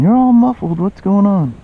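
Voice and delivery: squeaky high voice, mock confused; high mock viewer voice. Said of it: "probably going".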